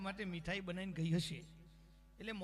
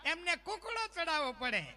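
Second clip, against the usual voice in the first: high-pitched insistent questioning; indignant believer; 7 semitones higher than usual. Retold or imitated. Believer quizzes artist about goddess's mount, high indignant voice. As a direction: pitch it high, as if it were obvious.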